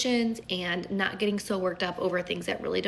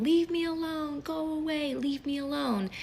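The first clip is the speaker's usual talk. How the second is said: mock child's yell; 8 semitones higher than usual. Mother imitating her upset son in the kids club, mock yelling. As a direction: mimic an upset boy yelling, pitched well up. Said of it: "He's yelling".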